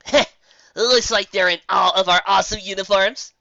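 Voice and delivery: a smug boast to a friend; Rainbow Dash smirking. Acted. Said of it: "Rainbow said to Rarity with a smirk".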